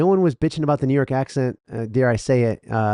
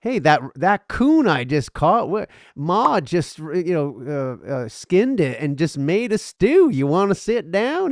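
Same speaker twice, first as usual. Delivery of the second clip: higher-pitched hillbilly Southern twang; country Southern accent; 4 semitones higher than usual. Acted. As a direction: play a backwoods Southerner inviting company in. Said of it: "what, the one I just did".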